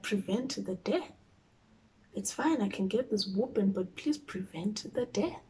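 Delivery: soft plea. Retold or imitated. Retelling her prayer to viewers, softly pleading. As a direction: beg softly, half-joking.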